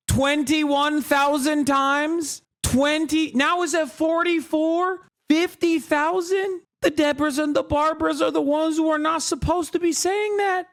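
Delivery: high, mounting disbelief; high incredulous counting. Real, not acted. Incredulous at emoji reaction counts.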